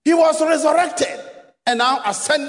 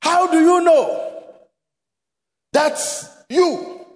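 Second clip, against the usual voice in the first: higher, slow preacher's call; preaching at full force; 5 semitones higher, slower than usual. Real, not acted. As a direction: press the question on the church. Preacher's rising appeal.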